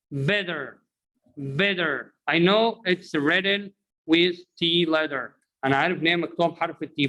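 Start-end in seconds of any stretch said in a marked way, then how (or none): 0.1-0.8 better said bedder; American flap T. Shown as a demonstration. Teacher drills class on better as bedder. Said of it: "Repeat after me". 1.4-2.1 better said bedder; American flap T. Shown as a demonstration. Teacher drills class on better as bedder. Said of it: "Repeat after me".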